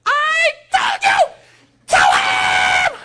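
put-on very high, loud, slow shrieking child; screaming little girl. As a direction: wail it out like a furious five-year-old.

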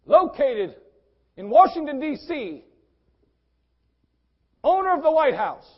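high, loud proclamation in separate bursts; hammed-up holler; calling out. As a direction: hail someone formally from a distance. Acts hailing the president.

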